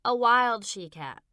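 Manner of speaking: loud, slow insistent correction; correcting child. Acted. Boy correcting his mother, loud and insistent. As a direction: set her straight firmly. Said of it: "Frankie corrected her".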